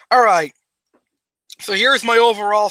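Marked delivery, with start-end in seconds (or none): 0.1-0.5 relieved sigh after a swallow; while sipping a drink. Real, not acted. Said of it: "so you can actually hear me sipping".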